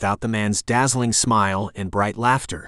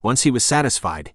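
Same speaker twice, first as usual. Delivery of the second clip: plain reading voice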